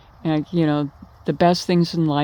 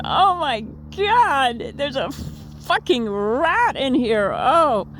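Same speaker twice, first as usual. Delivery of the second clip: high, slow, horrified rat discovery; shocked shriek; 9 semitones higher, slower than usual. Retold or imitated. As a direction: squeal it out as if the rat just jumped past you. Sudden rat in motel room.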